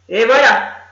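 put-on loud, pleased grumpy old man; gloating grump. A narrator's reading. Gronchon crows over the trapped rabbits, loud and gloating. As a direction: crow over the blocked burrow. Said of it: "dit-il en se frottant les mains".

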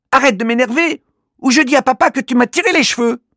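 put-on high child voice, fast and cross; annoyed little girl threatening. Alori threatening to tell their father, fast cross girl voice. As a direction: threaten to tell dad, rattling it off. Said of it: "réplique Alori".

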